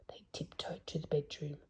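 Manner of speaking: hushed unvoiced whisper. A narrator's reading. Reader whispering as they tiptoe to the bedroom. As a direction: breathe it like sneaking up on someone.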